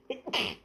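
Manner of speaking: a real sneeze; sudden sneeze mid-sentence; sneezing. Real, not acted. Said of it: "Attendez, je vais éternuer".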